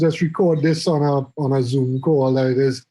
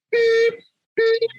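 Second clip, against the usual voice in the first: two very high, even beeps; imitated time-signal beeps. Host mimicking the speaking clock, high-pitched beeps. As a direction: squeak out a high electronic tone.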